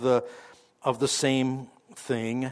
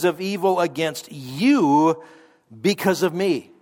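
slow, emphatic reading hitting you; stress on you; 3 semitones higher, slower than usual. Shown as a demonstration. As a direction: press hard on every you. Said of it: "If it sounded like I emphasized the word "you".

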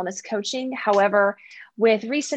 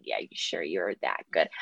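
much lower, softer doubting aside; low, muted self-doubt, acted out; skeptical inner voice; 6 dB quieter than usual. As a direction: drop low and quietly question the boast. Acts out doubting reply to boast.

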